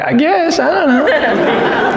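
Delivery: put-on high, drawn-out child's voice; slow, squeaky-high little kid answering; little girl. Little girl answering strangers who teased her, high hesitant child's voice. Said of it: "She's just a little girl".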